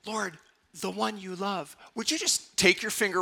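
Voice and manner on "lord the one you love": soft, tender prayer line; gentle prayer. Shown as a demonstration. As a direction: pray it softly and humbly. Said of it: "We can say".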